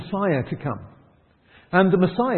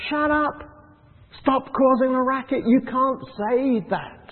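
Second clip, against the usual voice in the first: a hint of crowd scolding; hushing him; 8 semitones higher than usual. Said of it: "And they're saying".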